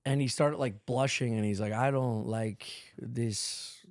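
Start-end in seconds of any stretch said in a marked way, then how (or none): 1.7-3.8 put-on low, slow Puerto Rican accent; exaggerated Puerto Rican accent, halting and soft. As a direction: imitate a bashful mover with a Puerto Rican accent. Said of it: "I'm giving him, like, a hard Puerto Rican accent".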